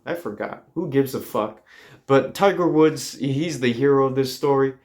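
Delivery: ordinary delivery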